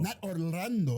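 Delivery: slow "Orlando" with the R sounded, as the wrong way. Shown as a demonstration. Co-host shows the rejected name, "Orlando" with its R. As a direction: stretch "Orlando" and put the R in. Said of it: "the R out".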